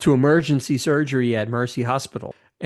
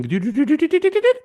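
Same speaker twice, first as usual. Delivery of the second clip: rising beeping climbing higher; device beeps; 13 semitones higher, much faster than usual. Retold or imitated. Imitating the future medical device fixing Chekhov's head. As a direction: chirp it up like a sci-fi gadget.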